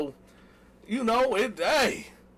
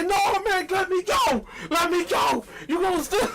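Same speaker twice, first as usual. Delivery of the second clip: hammed-up shout; 11 semitones higher than usual. To his girlfriend, a hammed-up yell to let him go.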